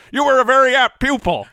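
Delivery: high, loud halting Jimmy Stewart; Jimmy Stewart impression. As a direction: channel old Jimmy Stewart. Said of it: "our Jimmy Stewart off".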